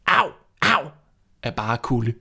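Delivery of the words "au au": higher, louder cawing; raven's cry. A narrator's reading. Raven on the fence crying from cold, cawing. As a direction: caw like a freezing raven. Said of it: "ravnen og skreg".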